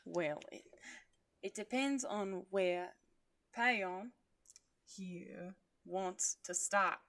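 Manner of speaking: put-on Jaguar's heavy accent, drawn out. A narrator's reading. Jaguar explaining to Buck in his put-on accent. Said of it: "Jaguar said in his accented voice".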